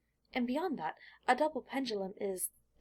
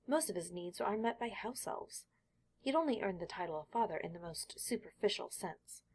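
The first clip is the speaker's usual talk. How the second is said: ordinary delivery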